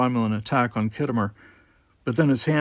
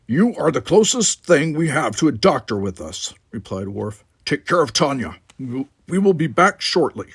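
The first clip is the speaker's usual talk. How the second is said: louder firm Klingon voice; calm command; Worf's voice; 4 semitones higher, 5 dB louder than usual. Acted. Worf instructing Selita, firm and louder.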